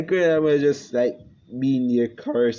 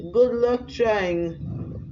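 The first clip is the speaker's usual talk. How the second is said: high, very slow sarcastic drawl; mocking taunt; 8 semitones higher, much slower than usual. Naruto taunting his father with a slow high sneer. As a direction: draw out the taunt to needle him.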